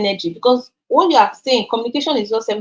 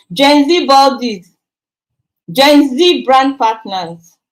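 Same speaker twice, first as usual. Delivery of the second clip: Gen Z drawn out slowly and louder; teasing call-out; 4 semitones higher, 6 dB louder, much slower than usual. Real, not acted. Scolding young followers, a slow, loud teasing call-out. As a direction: tease the Gen Z crowd, stretching each word. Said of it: "teasing call-out".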